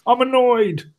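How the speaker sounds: high-pitched mock toddler tantrum; whining child. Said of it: "that's what children do".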